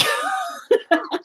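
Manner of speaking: real high burst of laughter. Real, not acted. Laughing at his own hernia story, high burst. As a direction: crack up at your own joke.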